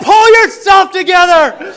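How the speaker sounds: slow, high, full-force mock-heroic yell; movie-hero motivational shout. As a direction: bellow it like a hammed-up action hero. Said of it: "some terrible movie script motivational speech".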